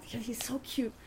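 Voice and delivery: hushed, smitten murmur to oneself; soft whispered cooing; shoulder-devil whisper. Retold or imitated. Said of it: "angel and devil on my shoulder".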